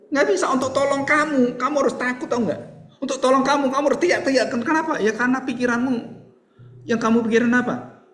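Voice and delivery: put-on doctor urging a child, high-pitched; dokter membujuk anak. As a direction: play an insistent doctor talking to a child. Acting doctor persuading the scared child. (dokter membujuk anak dengan nada tinggi)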